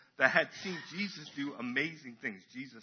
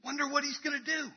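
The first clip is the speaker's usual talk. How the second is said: high-pitched, wide-eyed wondering; eager anticipation; 12 semitones higher than usual. Acted. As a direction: play an expectant onlooker, voice pitched right up. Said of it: "The group that was expectant".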